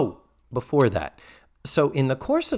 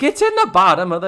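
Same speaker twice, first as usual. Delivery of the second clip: higher, louder, fast impatient repeat; 6 semitones higher, 7 dB louder, faster than usual. Retold or imitated. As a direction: snap it out, losing patience. Mrs. Garrett repeating herself impatiently.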